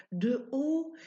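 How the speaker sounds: ordinary delivery, nothing marked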